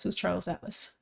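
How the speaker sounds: ordinary talk, nothing marked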